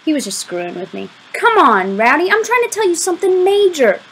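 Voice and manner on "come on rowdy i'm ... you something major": high, urgent plea; exasperated pleading. A narrator's reading. Boy begging his friend to take him seriously, exasperated.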